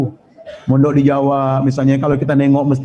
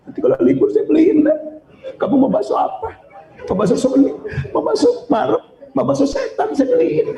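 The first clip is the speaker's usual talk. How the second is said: much higher, laughing half-sobbing mother; tearful mother; 11 semitones higher than usual. Retold or imitated. Mother promising her son meatballs, mock-tearful and high. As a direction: play the weeping mother promising treats. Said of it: "Istri langsung nangis dia".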